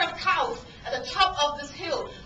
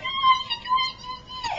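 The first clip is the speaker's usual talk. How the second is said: very high, hammed-up wail; high-pitched squeal; 5 dB louder, faster than usual. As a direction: squeal it out far above normal pitch. Acting panic while standing at edge.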